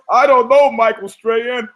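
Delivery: high, hammed-up co-host reply; mock TV co-host. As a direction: send up a fake-bright TV co-host. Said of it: "the old two shots of guys".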